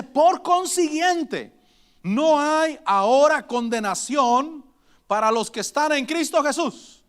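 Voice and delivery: slow, lifted verse reading; proclaiming the promise from the pulpit; solemn scripture reading. Acted. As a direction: read the verse out slowly and solemnly. Preacher reads verse slowly.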